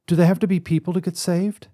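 put-on high, fast childish question; a boy pushing back at grown-ups; indignant child. Son challenges his father about saving creatures, high and fast.

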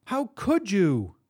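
high, slow, hurt accusation; pleading outrage, drawn out; angry wife. A narrator's reading. Lisa confronts him, wife's anger.

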